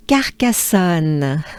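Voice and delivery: Carcassonne slow, with a French nasal end. Shown as a demonstration. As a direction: say Carcassonne with care. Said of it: "let's break it down".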